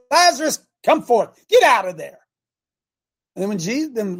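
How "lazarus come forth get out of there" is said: loud, high call into the tomb; commanding call to come out. Acted. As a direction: shout it out to a dead man in the grave. Jesus calls Lazarus again.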